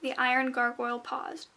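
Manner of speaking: usual speaking voice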